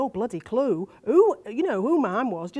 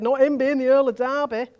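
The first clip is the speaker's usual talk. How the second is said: louder mock-scandalised voice; disapproving aunt and uncle; 3 semitones higher, 6 dB louder than usual. Niece quotes relatives guarding the Earl's name, mocking imitation. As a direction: imitate relatives fretting over their standing. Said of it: "they said".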